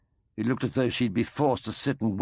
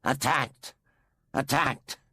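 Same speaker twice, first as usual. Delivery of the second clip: echoing alien exclaim; 5 semitones higher, slower than usual. Acted.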